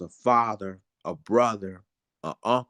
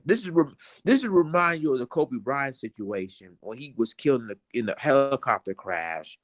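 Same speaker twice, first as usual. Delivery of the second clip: ordinary delivery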